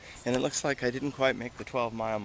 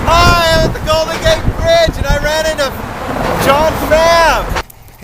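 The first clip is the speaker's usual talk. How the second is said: loud excited greeting; 15 dB louder, slower than usual. Real, not acted. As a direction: call out with delight.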